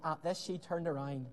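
usual speaking voice

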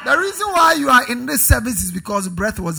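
loud, insistent preacher's refrain, building; full-force preaching. Real, not acted. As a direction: hammer each reason home to the congregation. Drives the point at congregation.

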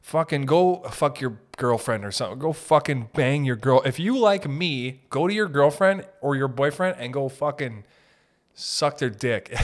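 usual speaking voice

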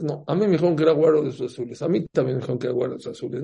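very fast mock bickering; rattled-off rival claims. Two mothers-in-law arguing before the king, hurried.

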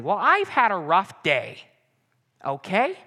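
hammed-up defensive complaint; self-justifying. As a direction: plead your own excuse, drawn out. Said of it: "we justify and say".

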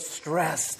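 "stressed" dropped low and soft, falling. Shown as a demonstration. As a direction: sigh the word "stressed" down. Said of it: "Everybody say that word".

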